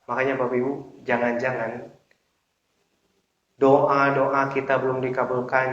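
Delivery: ordinary talk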